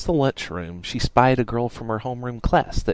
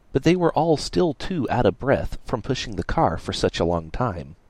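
ordinary delivery

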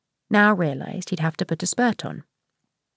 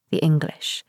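put-on Northern Irish accent. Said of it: "Northern Irish".

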